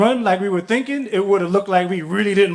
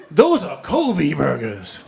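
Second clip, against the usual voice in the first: snooty correction; 4 semitones lower, slower than usual. Retold or imitated. Snooty man corrects them.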